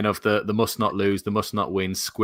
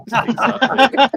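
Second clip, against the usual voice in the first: high laughing exactly; 13 semitones higher, 7 dB louder, slower than usual. Real, not acted. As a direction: laugh through the word. Laughing at squirrel votes.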